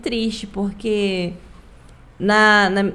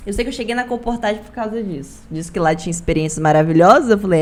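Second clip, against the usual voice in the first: normal talking voice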